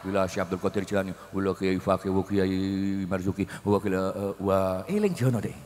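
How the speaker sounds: low, murmured dedication of the Fatihah; prayer recitation. Acted. Preacher reciting the tahlil names for the dead.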